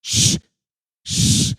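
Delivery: loud hissed shh; hard hissing shush; sharp shushing. Acted. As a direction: hush someone urgently. Character hushing loudly.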